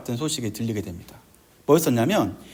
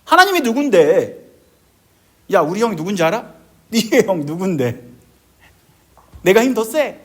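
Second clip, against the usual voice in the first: cocky mock challenge, raised pitch; dismissive taunt; 3 semitones higher, 5 dB louder than usual. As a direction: act out sneering one-upmanship. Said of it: "무시하는 거죠". (깔보며 도발하는 말투)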